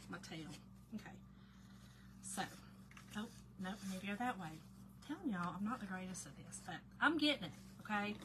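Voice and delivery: ordinary talk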